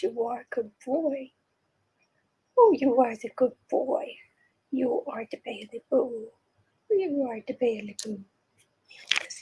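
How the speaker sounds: tender cooing to a cat; doting praise for a good kitty. Real, not acted.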